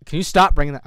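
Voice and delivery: loud exasperation at a pop-up; annoyed outburst. Real, not acted. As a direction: snap at the screen in irritation.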